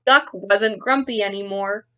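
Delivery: put-on lively read-aloud for children; story narration. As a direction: read it like a picture book to kids. Teacher reads picture book aloud.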